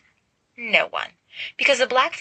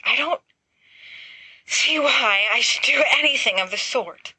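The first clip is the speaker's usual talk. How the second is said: panting; 6 semitones higher than usual. A narrator's reading. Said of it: "Remus pants".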